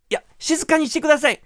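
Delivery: insistent receptionist. Retold or imitated. Receptionist repeats request to deaf granny, insistent.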